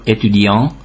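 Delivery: étudiant with the final T left silent; French étudiant, ending on a nasal vowel; silent final consonant. Shown as a demonstration. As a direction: say étudiant without sounding the last T. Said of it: "csak akkor ejtjük ki".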